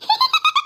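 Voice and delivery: very high, short tittering laugh; squeaky high staged titter; ghostly giggle. Acted. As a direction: giggle like a mischievous spirit. Ghost girl giggles when summoned.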